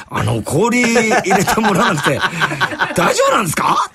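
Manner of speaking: teasing question. Retold or imitated. Teasing the old man.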